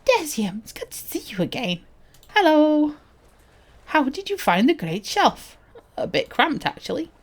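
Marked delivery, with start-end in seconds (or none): 0.1-5.6 higher, animated greeting in character; lightly acted quest-giver voice; friendly quest character. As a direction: greet a returning adventurer as a game character.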